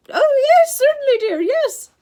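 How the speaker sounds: loud, high, fawning falsetto. A narrator's reading. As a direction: placate a monster in a false lady's voice. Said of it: "Grover said in his falsetto voice".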